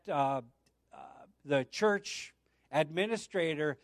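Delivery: ordinary talk, nothing marked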